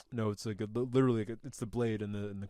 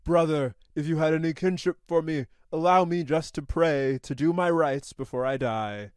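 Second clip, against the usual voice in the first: louder, solemn, earnest plea; pleading warrior; 4 semitones higher, 5 dB louder than usual. Retold or imitated. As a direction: beg your brother for a last prayer. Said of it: "And Hawk's like".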